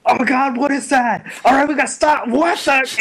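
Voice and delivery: high-pitched retold alarm; hammed-up startled outcry; mock panic. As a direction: play a crowd of panicking henchmen. Voices alarmed gangsters in story.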